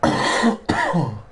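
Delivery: game commentator. Acted. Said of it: "Why do I sound like a game commentator?".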